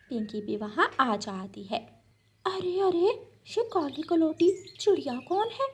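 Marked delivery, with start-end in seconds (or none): 2.4-5.7 put-on high, scornful girl's voice; snooty bird. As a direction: sneer at the dark little bird. Pinky sneers at dark bird.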